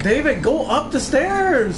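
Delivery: high, loud, stretched-out order; urgent call. Real, not acted. Co-player directing the gamer to escape, urgent loud call. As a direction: call it out slowly and loudly.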